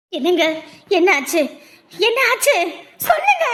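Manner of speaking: building from worried to frantic; anxious demand. Acted. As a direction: press for the news with rising panic. Anxiously demanding news after examination.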